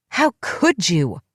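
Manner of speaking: slow, drawn-out furious cry; screamed. Acted. Lunging at the woman who betrayed her, screamed. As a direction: hurl the words at her, each one dragged. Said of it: "I screamed".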